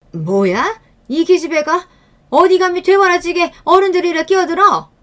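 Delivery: put-on an old woman shrieking at an insolent girl; outraged grandmother. A narrator's reading.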